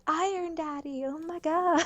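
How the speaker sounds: high-pitched mock swooning fans; gushing fan chat. Co-host mimicking the stream chat, high mock gushing. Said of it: "entire chat was just like".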